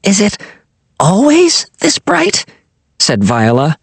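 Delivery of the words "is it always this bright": squinting amazement. A narrator's reading. Viola squinting in the sun.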